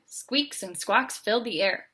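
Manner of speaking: plain reading voice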